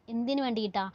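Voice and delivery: ordinary talk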